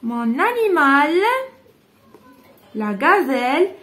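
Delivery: slow, drawn-out dictation of "La gazelle est mon animal préféré.". Shown as a demonstration. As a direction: dictate word by word so children can write. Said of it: "dictating sentence to pupils".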